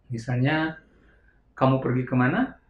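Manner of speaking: ordinary talk, nothing marked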